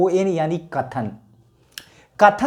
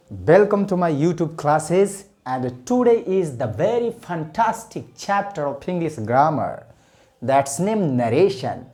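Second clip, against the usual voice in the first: slow English intro; much slower than usual. Acted. Teacher's English class intro.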